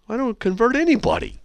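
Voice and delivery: high-pitched loud denial; mock protest. Host answering a critic's email, mock protest.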